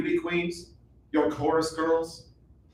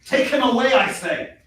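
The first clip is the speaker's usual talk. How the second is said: higher, louder repeat of the order; angry command; 5 semitones higher, 3 dB louder than usual. Acted. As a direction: lose patience with the soldier. King repeats ignored order.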